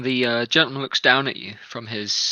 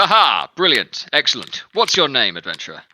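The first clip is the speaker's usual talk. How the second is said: delighted captain; 2 semitones higher, 6 dB louder than usual. Acted. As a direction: cheer the shot, booming. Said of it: "he chuckles and says".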